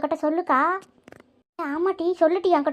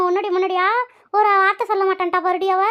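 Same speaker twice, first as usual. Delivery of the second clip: ordinary talk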